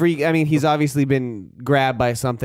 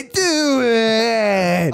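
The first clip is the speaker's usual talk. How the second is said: high, long drawn-out imitated scream; mock dying scream; 6 semitones higher than usual. Mimicking the dying soldier's plea, mock scream. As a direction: stretch it into a hammed-up yell. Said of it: "I thought you were trying to say".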